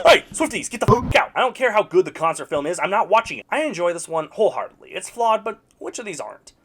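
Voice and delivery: normal talking voice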